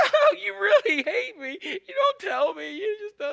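high, wailing mock-crying woman; hammed-up tearful whine, dragging out the complaint; Delilah. Delilah accusing Samson of hating her, mock wail. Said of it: "That's my Delilah for the day".

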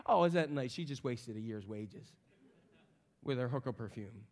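hushed sarcastic gossip; snide aside. Pretend. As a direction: mutter it under your breath to a neighbour.

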